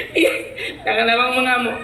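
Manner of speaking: usual speaking voice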